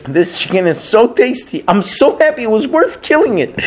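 high, gushing mock rapture; gleeful diner. Rabbi quoting the diner's rapture over his chicken, high and gushing. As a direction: rave it like a man in food euphoria. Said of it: "But he's like".

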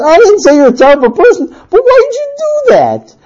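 high-pitched husband losing patience; exasperated protest. Acted. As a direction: rush in, then stretch the high question. Husband protesting in acted quarrel.

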